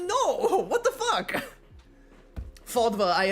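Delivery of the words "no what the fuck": high laughing outburst at the guesses; laughing disbelief. Real, not acted. Streamer laughing at chat's guesses about his accent, high laughing disbelief. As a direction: laugh it out, way up high, can't believe it.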